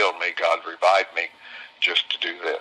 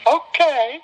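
put-on old lady voice; 5 dB louder than usual. Betty answers, high and happy.

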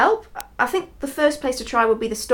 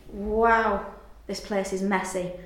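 ordinary talk

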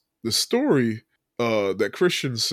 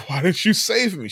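higher-pitched, indignant mock complaint; mock pissed off; 7 semitones higher than usual. Drowned woman confronts Jesus in heaven, mock pissed off. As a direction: imitate someone feeling let down and cross. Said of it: "She's pissed off".

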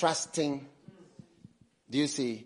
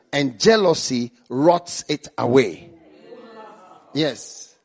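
ordinary talk, nothing marked

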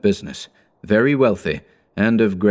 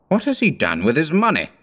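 high, prim gentleman; a fussy toff asking; high merchant voice; 8 semitones higher than usual. A narrator's reading. Red-faced gentleman asks.

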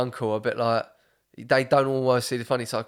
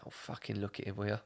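nan's blunt grumble, much softer and lower; mimicking nan; 3 semitones lower, 13 dB quieter than usual. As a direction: mutter it like a hard old East London nan at a deathbed. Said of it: "She was going".

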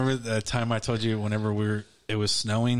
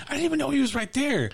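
very high, protesting imitation; Blair. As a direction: mimic her defending herself in falsetto. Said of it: "She goes".

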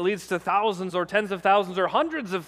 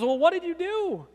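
questioning; 12 semitones higher, 3 dB louder than usual. Acted. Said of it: "God turns to Eve and says".

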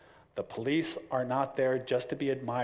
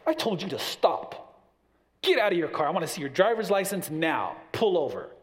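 louder, commanding officer; firm cop command; 4 semitones higher, 5 dB louder than usual. Retold or imitated. Officer orders driver, a firm louder command. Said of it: "step in front of you".

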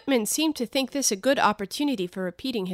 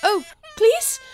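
higher, louder panicked plea; terrified cry; 9 dB louder, slower than usual. Acted. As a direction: cry out in terror. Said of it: "cried Alice ... agony of terror".

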